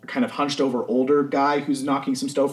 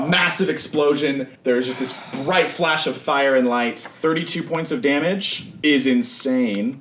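ordinary delivery

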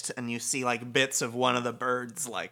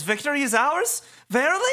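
high, loud, incredulous questions; amazed devotee; 6 dB louder, faster than usual. Acted. Nagi on hearing they won, amazed.

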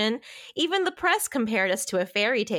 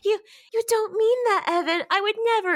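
pitched way up, a put-on girly heroine; mock heroine voice; 13 semitones higher, 3 dB louder, faster than usual. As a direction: play the pleading heroine in a high put-on voice. Voicing the heroine in recap.

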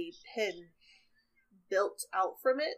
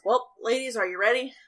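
put-on hearty old-timer, higher and louder; rally the ladies like an eager old guide; lightly voiced hearty old man; Wilfred's voice; 4 semitones higher, 9 dB louder than usual.

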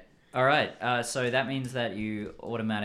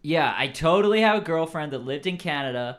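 loud, higher-pitched surfer insistence; SoCal surfer accent; 4 semitones higher, 8 dB louder than usual. Acted. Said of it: "I'm doing the stereotypical SoCal surfer accent".

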